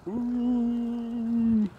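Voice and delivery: high eerie ooh; spooky ghost hoot. Pretend. Summoning Candyman ghost sound.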